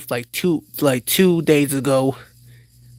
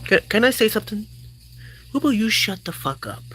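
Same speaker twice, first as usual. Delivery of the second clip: fast and higher, cutting him off; higher, fast furious interruption; angry outburst; 7 semitones higher, faster than usual. Acted. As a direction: snap at him to shut up. Senator explodes at attorney.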